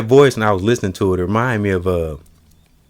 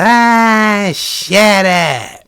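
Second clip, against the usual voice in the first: Bugs Bunny impression; 12 semitones higher, much slower than usual.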